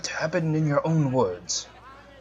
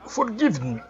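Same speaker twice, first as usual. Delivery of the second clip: put-on accidental Australian accent; 7 semitones higher than usual. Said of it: "Oh, that was Australian".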